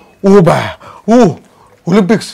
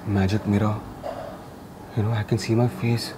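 dreamy low voice; 11 semitones lower, 11 dB quieter than usual. Acted. Trippy space bit, low voice.

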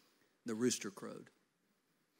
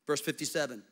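normal talking voice

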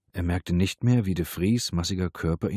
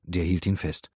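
usual speaking voice

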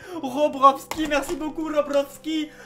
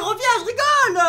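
higher and louder calling back; 10 semitones higher, 8 dB louder than usual. Real, not acted. Big brother calling his little brother back, loud. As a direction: call him back, teasing.

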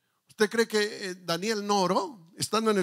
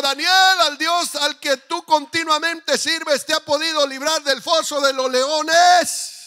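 calling out; 6 semitones higher, 5 dB louder, slower than usual. Acted. King calls into the lions' den.

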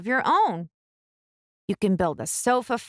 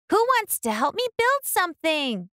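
put-on higher, eager little girl asking; hopeful girl's request, pitched up; Ruby's voice; 10 semitones higher than usual. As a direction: ask brightly for a helper. Ruby asks her brothers.

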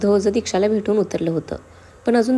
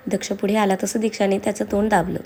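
ordinary delivery

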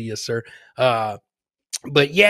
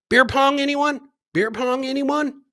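playful hype call; 10 semitones higher, 4 dB louder than usual. Real, not acted. Host teasing the clip.

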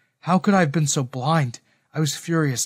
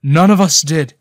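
loud, desperate protest; creeping desperation; 2 semitones higher, 11 dB louder than usual. A narrator's reading. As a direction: insist loudly in self-defence. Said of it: "desperation creeping into his tone".

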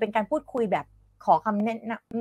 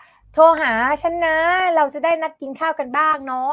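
higher, lilting chatty delivery; friendly acted invitation; 6 semitones higher, 4 dB louder than usual. Teacher acting a friend asking to be called for dinner, higher and chatty. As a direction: play a friend making casual dinner plans.

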